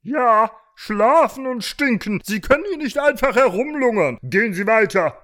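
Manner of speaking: high, loud scolding rooster; indignant high-pitched cop; officious rooster policeman. Acted. Story figure: rooster policeman.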